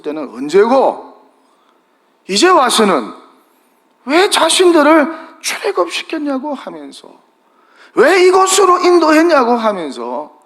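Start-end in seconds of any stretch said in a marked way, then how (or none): 4.1-9.5 high whiny mock complaint; a hint of a whining crowd, pitched high; mocking complaining people. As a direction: voice the grumbling crowd, mocking them. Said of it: "원망하고".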